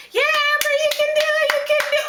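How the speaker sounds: very high, loud cheering shout; screaming encouragement. Retold or imitated. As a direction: scream it at the finish line like a fan. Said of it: "I would scream".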